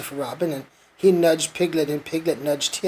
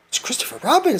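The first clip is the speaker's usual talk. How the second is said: eager; 7 semitones higher, faster than usual. A narrator's reading. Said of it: "said Pooh eagerly".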